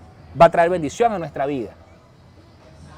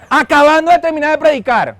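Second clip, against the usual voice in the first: laughing; 8 semitones higher, 7 dB louder than usual. Real, not acted. Laughing at himself mid-story.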